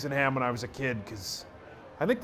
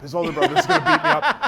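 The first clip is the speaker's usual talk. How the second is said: high, loud comic panic; hammed-up fretting with laughter around it; mock scared; 10 semitones higher, 5 dB louder than usual. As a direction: blurt it out in pitched-up mock fear. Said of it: "I was so scared".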